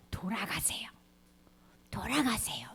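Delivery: soft unvoiced plea to turn back; gentle whisper. God gently urging people to return, whisper. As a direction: whisper it tenderly, pleading. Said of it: "어떻게?".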